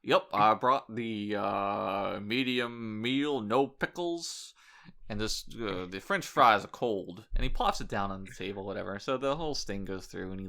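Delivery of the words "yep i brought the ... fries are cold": slow, odd put-on voice of a blasé guy; deadpan predator voice. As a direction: drag it out like you don't care. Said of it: "And he's like".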